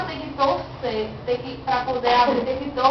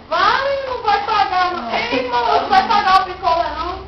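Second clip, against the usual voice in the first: high, loud indignant yelling after someone; indignant shouting; 8 semitones higher, 7 dB louder than usual. Retold or imitated. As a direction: shout after the customer who didn't pay. Vendor calling after a non-payer. (gritando indignada com quem não pagou)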